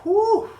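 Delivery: big high whooshing blow of breath; loud exhale. Real, not acted. Coach to viewer mid-workout, loud winded whew. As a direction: blow it out hard after the effort. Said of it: "I'm a little bit out of breath".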